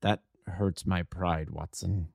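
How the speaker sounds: quiet and slow, wounded pride; dejected. A narrator's reading. Holmes to Watson, shaken and quietly hurt. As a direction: Holmes admits defeat to Watson, quietly downcast. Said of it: "more depressed and shaken than I have ever seen him".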